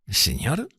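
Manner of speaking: soft, deferential servant's reply; bow and answer quietly; deferential waiter. A narrator's reading.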